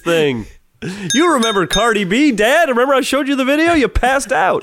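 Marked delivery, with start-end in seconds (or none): 1.1-4.6 high, chatty mom voice; playing the mom at the party. Playing mom talking to dad.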